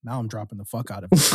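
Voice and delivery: low, deadpan mock defiance. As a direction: announce it like there's no choice. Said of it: "And I'm like".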